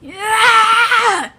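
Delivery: drawn-out, high, loud yell; long scream. Acted. Creature about to explode, screaming. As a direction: scream it out in one long burst.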